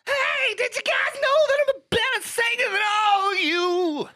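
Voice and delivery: very high, shrieked mock singer boast. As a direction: screech it up high like an over-the-top rock diva.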